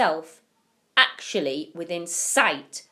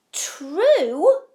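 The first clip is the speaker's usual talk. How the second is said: high, loud disbelieving cry of True; astonished cry; 11 semitones higher, 10 dB louder than usual. A narrator's reading. Grandparents amazed at Charlie's question, high astonished cry. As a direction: grandparents crying out in shock. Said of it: "cried all four of them at once".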